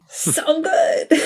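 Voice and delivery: high, emotional so good; gushing. Real, not acted. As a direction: swoon over it. Overwhelmed by the lyrics.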